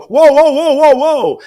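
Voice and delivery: loud, high-pitched string of whoas; alarmed whoas. Retold or imitated. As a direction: cry out as if stopping someone lunging. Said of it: "I hear him go".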